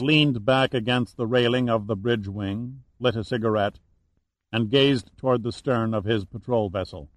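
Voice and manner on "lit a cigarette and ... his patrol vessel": ordinary delivery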